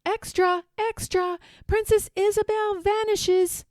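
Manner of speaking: high, loud street cry; newsboy shouting. A narrator's reading. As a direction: hawk the headline to the street. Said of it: "hears the newsboy shouting".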